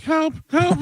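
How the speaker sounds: tiny, very high cry for help; thrown voice. Retold or imitated. Prankster fooling the whole store with a high mock cry for help. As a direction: imitate a ventriloquist's squeaky trapped victim. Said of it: "change his voice and throw a little".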